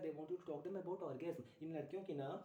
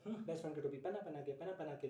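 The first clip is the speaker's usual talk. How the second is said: usual speaking voice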